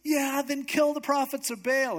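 high, rousing command; excited. Retold or imitated. Prophet ordering the excited crowd, high and fired up. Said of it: "they're so excited that he goes".

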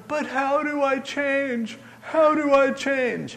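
high, drawn-out pleading wail; imitated desperate man. Poor guy cornered in the sanctuary, imitated desperate wail. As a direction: stretch it out as a mock plea. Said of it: "the guy's going".

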